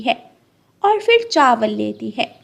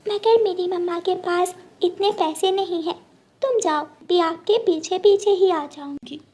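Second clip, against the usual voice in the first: put-on higher, brave little girl; 7 semitones higher than usual. Little bird telling friends she'll follow, higher childlike voice. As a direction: shrug it off sweetly, a child's voice.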